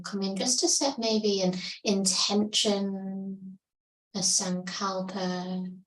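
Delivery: calm yoga teacher guiding breath awareness; guided meditation. Acted. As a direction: ease them into stillness, unhurried. Leads a seated meditation.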